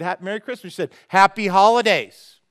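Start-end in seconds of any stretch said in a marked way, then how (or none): 1.1-2.4 loud, curt and slow; a clerk's curt brush-off; abrupt. Retold or imitated. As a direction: snap it back at him. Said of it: "she said it kind of abrupt".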